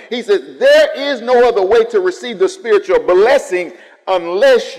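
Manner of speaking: loud, high, impassioned sermon voice; full-force preaching. Acted. Pastor quoting Jesus as the only door, full-force preaching. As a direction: thunder it to the congregation.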